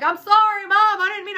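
high, loud imitation of an apologizing son; upset son. Son apologizing to mom over muddy dogs, upset imitation. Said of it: "my older one's getting upset".

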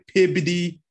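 Boston pronunciation of Peabody. Retold or imitated. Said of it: "they say, like".

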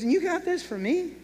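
a light mock of stunned disbelief; incredulous. Neighbour asking if the gift is really for him, incredulous.

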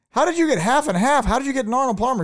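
high-pitched, fast retold outrage; mock incredulous. Imitating bar owner quizzing waitress over the drink, high-pitched incredulity. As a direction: demand an answer, voice shooting way up.

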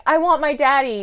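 put-on a little girl wanting her father; crying for daddy. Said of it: "her just being like".

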